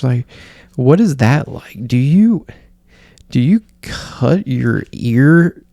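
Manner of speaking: exaggerated swooping questions, haltingly delivered; mock attack mode. Podcast host suddenly grilling her guest, mock attack. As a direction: imitate an interviewer needling her guest. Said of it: "she's in attack mode".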